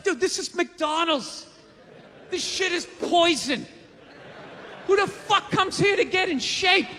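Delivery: slow then shouted, fed-up disbelief; exasperated rant. Retold or imitated. Mocking out-of-shape customers, exasperated shout. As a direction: build from scolding to a shout. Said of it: "It's like".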